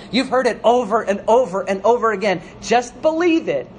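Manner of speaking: loud, high, rolling pulpit exhortation; impassioned preacher driving the words home; fervent preaching. Real, not acted. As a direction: urge the congregation with rising fervour. Exhorts congregation at full force.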